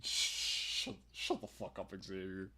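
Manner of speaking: very soft, low near-whisper. Acted. Mom leaning into her boy's ear, whispering. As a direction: hush it right down into someone's ear. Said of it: "as if to whisper something".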